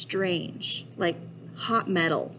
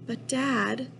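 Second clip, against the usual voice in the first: higher, lightly squeaky protest; a faint, pleading objection, pitched up; small squeak; 5 semitones higher than usual. A narrator's reading. Kid's last objection to dad, mildly squeaky. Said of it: "my voice was barely a squeak".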